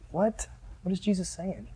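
very quiet, baffled aside; puzzled mourners. Acted. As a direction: murmur it in hushed confusion. Mourners puzzled by Jesus' words.

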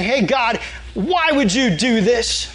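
acted indignant protest, stretching the question; accusing. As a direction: challenge God like a rebel. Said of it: "that rebellious heart to, like, accuse God".

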